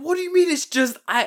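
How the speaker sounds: high, loud retold protest; imitating an incredulous little brother; indignant disbelief. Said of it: "And he's like".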